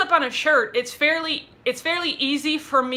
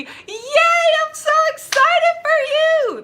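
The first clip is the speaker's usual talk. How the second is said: much higher, louder joyful cry; thrilled squeal; 5 dB louder, slower than usual. Real, not acted. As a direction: squeal with delight for her. Congratulates viewer on moving together.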